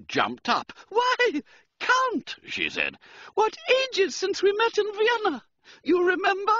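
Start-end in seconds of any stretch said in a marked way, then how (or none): 0.9-2.4 very high, loud female voice; high-pitched delighted exclamation; gushing society lady. A narrator's reading. Society lady greets old acquaintance. 3.4-6.5 very high, loud female voice; high-pitched delighted exclamation; gushing society lady. A narrator's reading. Society lady greets old acquaintance.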